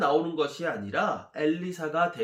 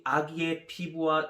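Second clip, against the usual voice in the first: ordinary delivery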